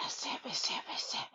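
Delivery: exaggerated unvoiced whisper imitation; mocking whisper. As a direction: whisper it, mimicking someone who always whispers. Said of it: "she always whispering this shit".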